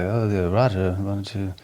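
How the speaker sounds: a light, badly done Swedish accent as Edberg; lightly voiced Swedish accent, half-hearted Edberg impression; terrible Swedish accent. Imitating Edberg advising Roger, in a weak Swedish accent. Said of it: "That was a terrible accent".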